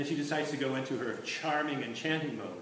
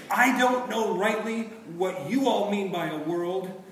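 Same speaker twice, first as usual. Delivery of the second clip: louder, slow and short of air; out-of-breath Puddleglum; 5 semitones higher, 7 dB louder than usual. A narrator's reading. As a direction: push the words out with too little breath. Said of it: "talking like a man who doesn't have enough air".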